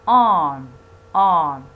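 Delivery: Arm called out loud and clear as a model; loud model word. Shown as a demonstration. Teacher models the word Arm loudly for students. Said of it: "The transcription is".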